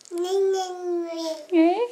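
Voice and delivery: soft crying neigh; whimpering neigh. Pretend. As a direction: neigh with a little whine. Mother imitates horse again.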